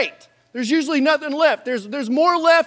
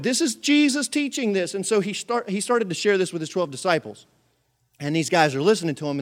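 ordinary delivery

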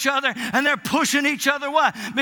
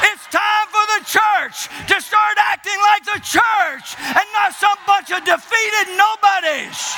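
loud rising sermon shout; preaching at full force; 6 semitones higher, 4 dB louder than usual. Acted. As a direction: build the preaching to a roar.

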